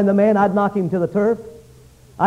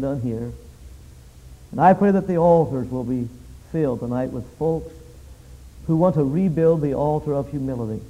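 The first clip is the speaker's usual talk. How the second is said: soft, hushed pulpit prayer; 5 semitones lower, 5 dB quieter than usual. Acted. Pastor praying over the meeting, hushed and earnest. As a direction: pray it low and earnest to God.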